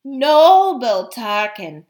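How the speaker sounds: slow, silky, drawn-out address; silky cat. Acted. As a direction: purr it smoothly like a flattering cat. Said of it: "in that silky voice of his".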